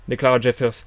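usual speaking voice

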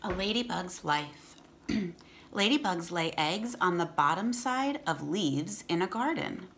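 clear, steady read-aloud voice; storybook reading for kids; reading to children. Acted.

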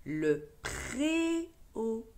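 préau drawn out slowly; slow model reading. Shown as a demonstration. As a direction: read le préau slowly for a child. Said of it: "reads word slowly for child". (lecture lente de le préau)

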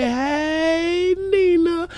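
high, drawn-out falsetto hello; falsetto greeting. Acted. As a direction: croon the name up high. Greeting a viewer by name.

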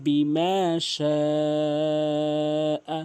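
slow melodic chant, ইল্লা lifted; Quran recitation. Shown as a demonstration. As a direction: recite slowly, lifting ইল্লা.

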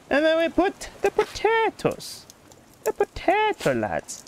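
drawn-out, high Irish lilt; slow mock-Irish delivery; Irish accent. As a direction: stretch it out like a put-on Irishman. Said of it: "speaking like an Irishman".

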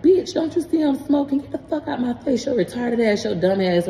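high-pitched retold scolding, easing down at the end; harsh scolding mother. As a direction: imitate a mother snapping at her child. Retells mama's harsh rebuff.

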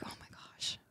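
breathless whispered oh my gosh; whispered yes. Retold or imitated. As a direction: hush it out in awe. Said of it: "I was like".

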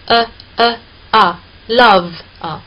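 呃呃啊 then love with the open vowel, slowly; slow drill leading into love; vowel demo. Shown as a demonstration. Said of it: "是这样发音的".

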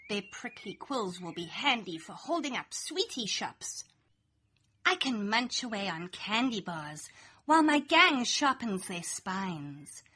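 ordinary delivery, nothing marked

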